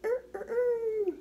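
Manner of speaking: high, loud, stretched crowing; rooster crow. Pretend. Showing the child how a rooster crows. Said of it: "cock-a-doodle-doo like a rooster?".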